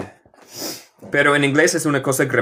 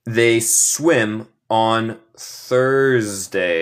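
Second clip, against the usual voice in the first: very slow, word by word; careful pronunciation model; much slower than usual. Shown as a demonstration. Teacher showing students the pronunciation, slow and careful. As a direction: separate and stretch each word for learners. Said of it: "Note mi pronunciación".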